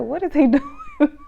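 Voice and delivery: laughing in disbelief; amused, incredulous laugh. Real, not acted. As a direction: ask it through a laugh, high. Laughing disbelief at G's antics.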